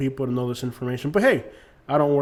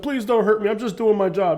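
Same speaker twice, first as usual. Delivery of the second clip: higher, pleading mock cop voice; 7 semitones higher, 3 dB louder than usual. Imitated cop begging the YouTuber to spare him, higher and pleading. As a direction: play the cop begging not to be hurt.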